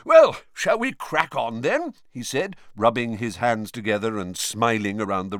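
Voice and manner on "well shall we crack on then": loud, high, eager urging; excited. A narrator's reading. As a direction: jump in keenly, pitched up and loud. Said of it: "looked rather excited".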